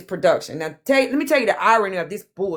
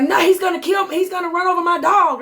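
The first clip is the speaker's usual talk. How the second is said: much higher, frantic panicked plea; mock panic; 10 semitones higher, 3 dB louder than usual. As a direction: squeal it like she's terrified. Panicked woman fearing Braid King.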